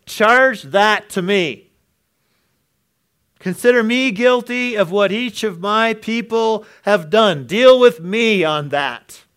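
high, loud, earnest plea; Jesus pleading. Acted. Jesus asking the Father to charge him. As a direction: offer yourself in their place, slowly.

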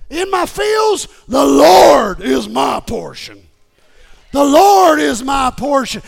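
very loud, high shouted declaration; full-force preaching. Acted. Preacher voicing Jeremiah's hope after losing everything, shouted preaching. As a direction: thunder it out with defiant faith.

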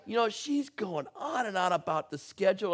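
normal talking voice

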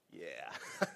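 ordinary delivery

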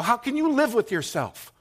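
high, loud outraged shout; shrieking outraged demand; retold yell. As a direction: yell it at the phone agent in outrage. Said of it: "and I'm yelling at her".

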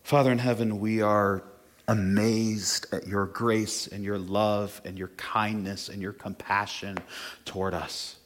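a pastor leading the congregation in prayer, hushed. Acted. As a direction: pray it humbly to God. Leads a prayer.

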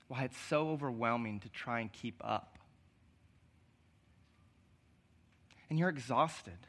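hushed, reassuring pastoral appeal; soft tender preaching. Acted. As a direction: speak gently to the hurting ones in the room. Quiet tender stretch of sermon.

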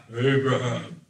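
low, slow godlike call; booming movie God. Retold or imitated. Preacher mimics movie God calling Abraham, low. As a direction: call him like Hollywood's God. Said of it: "the way people depict God".

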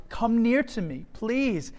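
pleading. A narrator's reading. Joseph pleads with his brothers.

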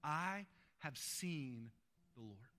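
very slow, hushed declaration; slow, quiet proclamation with weighty pauses; awed announcement. Acted. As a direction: spread the words out, sinking low and soft. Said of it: "busts in the room and she says".

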